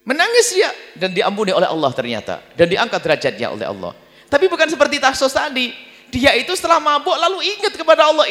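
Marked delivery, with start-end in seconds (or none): none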